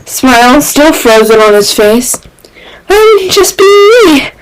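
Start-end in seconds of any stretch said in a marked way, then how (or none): 2.9-4.3 high, wide-eyed feigned innocence; high, mock-innocent question; innocent Reed. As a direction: play dumb with big eyes. Reed keeping up act.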